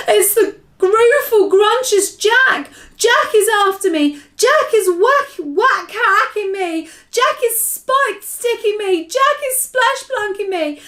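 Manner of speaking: high-pitched wailing terror; bellowed. A narrator's reading. Giant attacked by Jack in a nightmare, bellowing. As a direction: bellow each cry in rising panic, drawing it out. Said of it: "bellowed the flesh lump eater".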